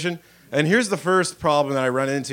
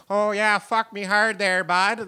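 put-on a light rural Canadian farm accent, a bit higher; a farmer's daughter's accent in bed, lightly done; farm girl accent; 5 semitones higher, slower than usual. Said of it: "That's what she would sound like".

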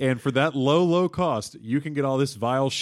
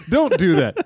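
louder, higher, half laughing; pleading warning; 4 semitones higher, 5 dB louder, slower than usual. Acted. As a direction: beg him not to. Repeated warning.

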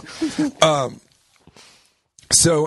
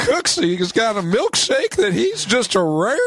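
put-on very high twangy old-timer; squeaky prospector bragging; 12 semitones higher than usual. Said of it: "sound like Don Knotts".

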